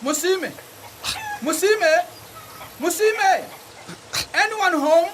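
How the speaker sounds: high, ringing calls toward the house; calling a name from outside the house. Acted. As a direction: call his friend's name from outside. Calling out at the gate.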